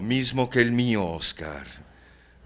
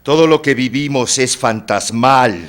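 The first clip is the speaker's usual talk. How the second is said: loud, higher-pitched proclamation; emphatic declaiming; 4 semitones higher, 9 dB louder than usual. Acted. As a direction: declare it forcefully, like a grim truth. Declaims to Oscar about ghosts. (declamación enfática y fuerte)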